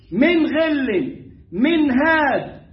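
very slow, clear نون before the throat letter; slow example reading. Shown as a demonstration. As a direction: spell out each example slowly. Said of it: "في حروف الإظهار".

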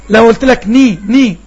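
ني said loud with the mouth dropping for kasra; demo of ني. Shown as a demonstration. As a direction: say ني loud and short. (نطق ني بوضوح)

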